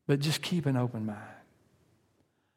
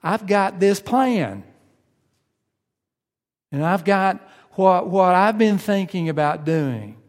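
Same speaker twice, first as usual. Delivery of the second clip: higher, louder, careless voice; a hammed-up I don't care; mock apathetic brush-off; 5 semitones higher, 5 dB louder than usual. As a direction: shrug God off, set on your own plan. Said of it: "We're apathetic".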